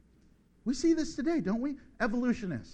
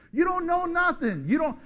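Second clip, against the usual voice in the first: higher, louder scolding; rebuking shout; 7 semitones higher, 3 dB louder than usual. Real, not acted. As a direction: hammer the point at them. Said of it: "preaching rebuttal".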